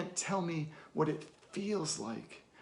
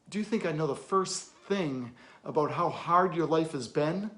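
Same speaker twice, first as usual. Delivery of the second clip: louder, higher, pointed rhetorical questions; heated challenge; 4 semitones higher, 8 dB louder than usual. Acted. Challenging an orphan who presumed to know him, heated. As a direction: fire each question at them, building in force.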